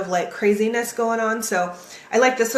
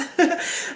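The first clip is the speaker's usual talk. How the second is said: light laugh; 8 semitones higher than usual. Real, not acted. Laughs at viewer Julie.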